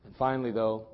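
preacher turns quiet and grave; hushed preaching. Acted. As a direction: drop it down low and quiet. Hushed sermon passage.

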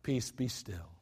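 soft, low, calm command; just speak it, no need to yell; calm quiet command to the sea; simply spoken. Shown as a demonstration. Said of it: "I think he spoke it".

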